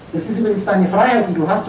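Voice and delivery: usual speaking voice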